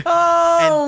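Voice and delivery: loud, high, excited exclamation; thrilled. Real, not acted. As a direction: cry out in delight at a favourite's return. Thrilled at a returning segment.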